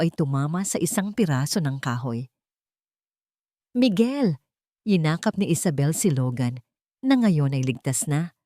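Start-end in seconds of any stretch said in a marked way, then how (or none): 3.8-4.4 panicked cry. Acted. Isabel sees Miguel collapse.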